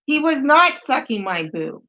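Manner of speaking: ordinary talk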